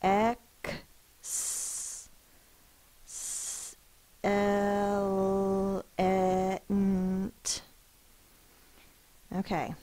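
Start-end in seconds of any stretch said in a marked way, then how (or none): 0.0-7.6 "Excellent" broken into slow parts; sounding out syllables. Shown as a demonstration. Teacher to class, segmenting excellent into parts. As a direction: split "Excellent" into ex, el, e, nt. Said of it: "Let's see if I've got enough boxes".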